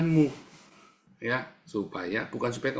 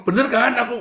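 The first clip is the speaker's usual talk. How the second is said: high, loud insistent question; seeking self-justification; 12 semitones higher, 10 dB louder than usual. Shown as a demonstration. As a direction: demand agreement, pitched way up. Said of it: "kamu sedang mencari pembenaran". (menuntut dibenarkan)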